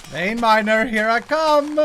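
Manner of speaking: high and loud triumphant call; excited cheer. Real, not acted. Streamer cheering his new vein miner, loud and high. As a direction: cheer it like a battle cry.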